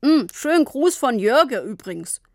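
mumbled through a mouthful of biscuits; mumbling with cookies. A narrator's reading. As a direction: talk around a mouthful. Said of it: "Papa aß Kekse und nuschelte". (mit vollem Mund nuscheln)

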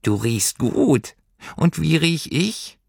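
high, slow creature voice; little creature enjoying the smell; slow high creature, content; pleased creature. Acted. Heimweh voice.

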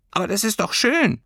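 high-pitched little creature reply; squeaky furry creature. A narrator's reading. Creature tells girl loving family is nice, squeaky. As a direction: pipe it up in a tiny voice.

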